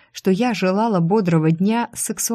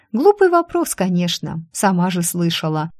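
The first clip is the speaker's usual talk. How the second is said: plain reading voice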